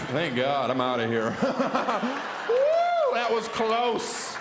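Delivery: very high loud whoop, then slow; relief. Acted. Relieved guy escaping fatherhood, loud whoop. Relieved dad fleeing the scene.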